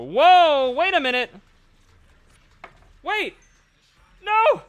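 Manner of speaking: high, loud, sudden excitement; excited gamer. Real, not acted. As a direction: yell in hopeful shock. Pull animation, excited.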